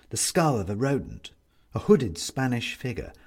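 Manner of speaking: ordinary delivery, nothing marked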